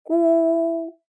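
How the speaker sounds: 咕 held high and level; 咕 in the flat high first tone. Shown as a demonstration. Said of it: "Let's try some more".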